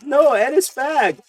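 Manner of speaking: slow, high mocking voice. Friends joking, mocking a TV actor in a high voice. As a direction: drawl it out in a high silly voice.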